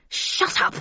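voiceless hissed command; cutting stage whisper; sharp hiss. A narrator's reading. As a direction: hiss it to silence someone. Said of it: "hissed Havisham".

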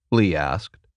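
plain reading voice

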